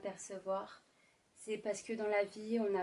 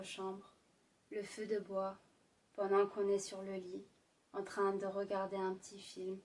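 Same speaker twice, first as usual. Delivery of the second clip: sultry narrator. Acted. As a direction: draw them into a romantic scene. Sultry ASMR romantic narration.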